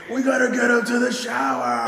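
high, loud shouted order; urgent shout. Retold or imitated. Friend Pat demanding the shower, loud and high. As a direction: yell it like a panicked friend. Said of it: "Pat's like".

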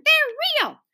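high wailed protest; crying out. A narrator's reading. Little guest insists to hostess, crying out. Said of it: "cried Susan".

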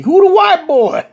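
high, loud, drawn-out question; mock startled uncle. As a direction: call it out slow and high. Uncle calls out on spotting guest.

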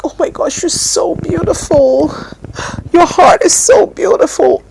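put-on emotional praise; imitated tearful gushing. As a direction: gush as if moved to tears, lightly mimicking. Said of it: "she will even be crying".

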